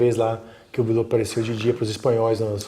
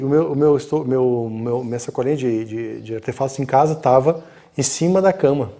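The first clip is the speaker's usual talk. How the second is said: normal talking voice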